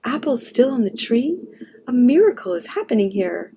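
amazed gasping. A narrator's reading. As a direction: exclaim in disbelief at a miracle. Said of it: "gasped Mama".

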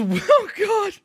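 high, laughing exclamation. Real, not acted. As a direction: break up laughing at the memory. Laughs recalling friend's walk.

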